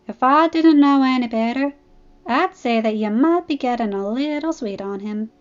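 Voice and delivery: lightly voiced country teasing; Applejack teasing. Applejack ribbing Fluttershy about Discord, lightly teasing.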